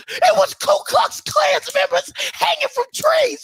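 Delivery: high, wailing retold claim; hammed-up tearful quote; mock crying. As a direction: sob the words, hammed up. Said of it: "my brother Ed was saying".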